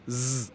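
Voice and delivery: "Z" as a held buzz; Z sound. Shown as a demonstration. Teacher showing single S mid-word, sustained buzz. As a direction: say "zzz" for "Z", voiced, no letter name. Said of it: "on le prononce".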